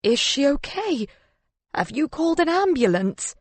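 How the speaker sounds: high, urgent questioning; panicked. A narrator's reading. Husband asking after his injured wife, panicked. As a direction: ask in alarm, pitched well up.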